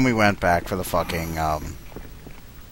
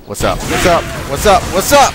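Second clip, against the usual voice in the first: from casual to loud high yell; building taunt; 10 semitones higher, 8 dB louder than usual. Pretend. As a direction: get louder each time. Repeated taunting greeting.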